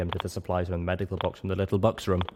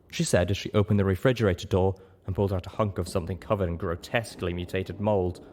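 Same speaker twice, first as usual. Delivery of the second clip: ordinary talk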